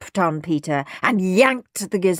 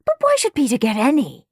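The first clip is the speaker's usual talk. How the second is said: indignant, higher-pitched grumbling; resentful; 6 semitones higher than usual. A narrator's reading. Henry thinking about his copycat brother, resentful. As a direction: grumble it with a sense of injustice. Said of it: "thought Henry resentfully".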